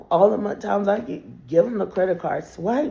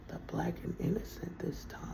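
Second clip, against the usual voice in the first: whisper; 14 dB quieter than usual. Real, not acted. Sarcastic aside.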